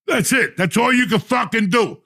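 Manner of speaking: loud, high, furious telling-off; high-pitched outraged scolding; angry rant. Retold or imitated. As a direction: tear into him at the top of your voice. Telling off the airport kid.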